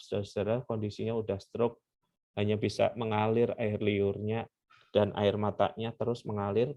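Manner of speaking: ordinary delivery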